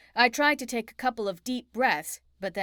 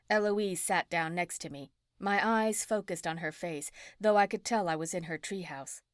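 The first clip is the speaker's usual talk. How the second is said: usual speaking voice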